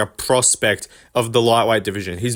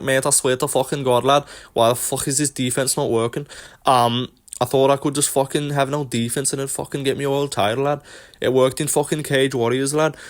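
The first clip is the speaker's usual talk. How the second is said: put-on lightly done Scouse lad accent, complaining; Paddy Pimblett impression. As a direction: ham up a cocky Scouse fighter moaning about his defence. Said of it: "I apologize for the accent".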